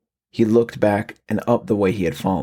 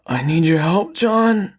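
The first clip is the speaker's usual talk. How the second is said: much higher, strained pleading of a monster mimicking a father; raspy pleading cry; 10 semitones higher, slower than usual. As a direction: cry for help like a creature wearing a dead man's voice. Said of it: "the raspy voice cried".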